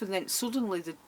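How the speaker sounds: ordinary talk, nothing marked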